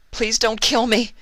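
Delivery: panicked high plea; anxious cry. Acted. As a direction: beg for your life. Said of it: "Vinyl cried out anxiously".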